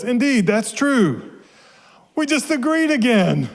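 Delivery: high-pitched storybook astonishment; amazed agreement. A narrator's reading. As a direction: marvel at it, voice climbing high. Characters amazed they agreed again.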